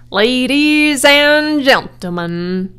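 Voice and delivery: loud, slow, drawn-out showman's opening; stretched, booming public address; loudspeaker announcer. A narrator's reading. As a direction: hail the whole crowd grandly. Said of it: "said the loudspeaker".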